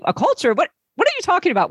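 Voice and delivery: high, rapid baffled questioning; incredulous questioning. Retold or imitated. Wife retelling husband's doubts about Appalachia, incredulous. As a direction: imitate a puzzled husband firing back questions.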